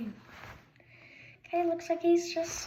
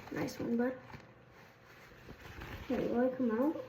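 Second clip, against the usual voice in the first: ordinary delivery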